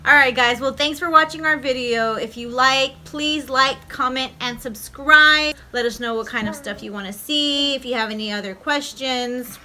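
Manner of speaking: loud upbeat sign-off to viewers; youtube outro. Acted. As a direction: sell the subscribe ask. Outro call to subscribe.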